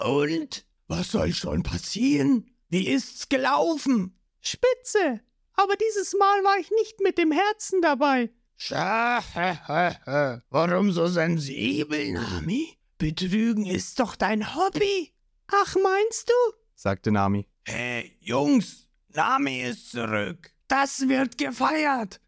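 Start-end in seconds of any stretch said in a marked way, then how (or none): 0.0-4.2 Arlong voice. A narrator's reading. Arlong speaks to Nami. 4.4-8.3 Nami voice. A narrator's reading. Nami answers Arlong. 8.6-15.1 Arlong teasing. A narrator's reading. Arlong teases Nami. 15.5-16.5 Nami voice. A narrator's reading. Nami replies. 17.6-22.2 Arlong calling. A narrator's reading. Arlong calls his crew.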